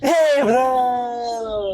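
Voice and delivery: drawn-out, triumphant cry; magician's delighted cry when the card turns up right; excited. Real, not acted. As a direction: savour the reveal, stretched long. Card trick succeeds.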